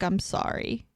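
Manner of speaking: soft, subdued mock apology; retold sad apology. She apologizes to him over the paternity test, mildly sad. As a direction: say it small and quiet, lightly acting her sadness. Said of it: "And she's sad".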